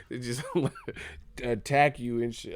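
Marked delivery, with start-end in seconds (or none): none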